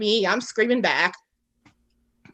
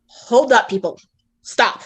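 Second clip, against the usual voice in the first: raised, louder call to halt; acted command; 3 semitones higher, 5 dB louder, slower than usual. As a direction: call out a mock royal order. Retold command halting the guards.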